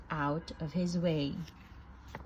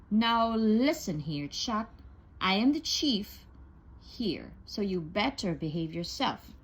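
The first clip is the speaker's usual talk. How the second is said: plain reading voice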